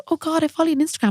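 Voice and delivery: high, quick fan gush; mock excited fan. Imitating a follower greeting their makeup artist, mock excited.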